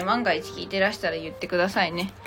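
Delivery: ordinary talk, nothing marked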